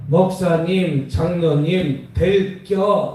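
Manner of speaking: slow, clipped-short Chungcheong dialect; Chungcheong accent. Retold or imitated. Pastor imitating northern Chungcheong speech, slow accent. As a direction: stretch 목사님 then drop short 될겨. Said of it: "조금 더 올라가니까".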